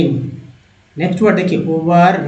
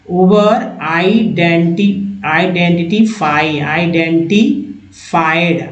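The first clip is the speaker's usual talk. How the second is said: slow, spelled-out identified; slowed model reading; slower than usual. Shown as a demonstration. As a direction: sound out identified slowly, syllable by syllable. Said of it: "teacher models word pronunciation".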